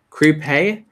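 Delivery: "creep hey" ending in AY instead of EE; old mispronunciation. Shown as a demonstration. Showing how he used to say creepy, "creep hey" ending in AY. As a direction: say "creep hey" with a long AY at the end. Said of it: "I used to say creepy".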